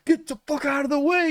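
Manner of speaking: high yell of a faker; mock scream. Fake-hurt scammer screaming at the drivers, high mock yell. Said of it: "He was, like, screaming".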